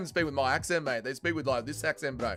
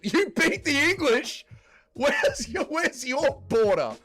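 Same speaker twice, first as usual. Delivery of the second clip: loud, high, drawn-out disbelief; exaggerated high-pitched scoffing question; mock incredulity; 11 semitones higher, 6 dB louder, slower than usual. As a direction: blurt a hammed-up challenge, pitched way up. Mock challenge to Scotland's claim.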